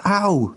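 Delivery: high-pitched "ow" at being hit; a quick acted yelp, pitched well up; mild pained yelp. A narrator's reading. Bamber smacked with back of hand, mild pained yelp.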